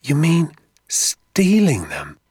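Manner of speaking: put-on scandalised young boy; aghast. A narrator's reading. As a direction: draw it out in horrified disbelief. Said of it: "I said, aghast".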